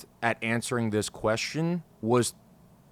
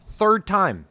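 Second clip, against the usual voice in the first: frustrated, louder and higher; exasperated; 8 semitones higher, 7 dB louder than usual. Real, not acted. Host fed up re-recording his episode, exasperated outburst. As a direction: snap it out, fed up with redoing it.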